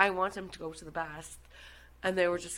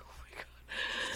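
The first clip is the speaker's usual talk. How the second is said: slow, breathless whisper of shock; whispered oh my God; 9 dB quieter, slower than usual. Retold or imitated. Boyfriend stunned at her outburst, whispered slowly. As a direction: whisper it, appalled. Austin reacting under his breath.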